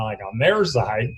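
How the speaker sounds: ordinary talk, nothing marked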